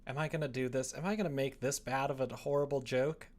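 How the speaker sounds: normal talking voice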